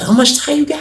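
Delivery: high, lifted surprised ask; lightly voiced startled inmate; surprised question. Cellmate asks how long his sentence is, in a high surprised voice.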